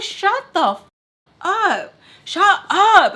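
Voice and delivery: retold shout. To Barry who keeps talking, a high loud shout. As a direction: yell it at her to stop.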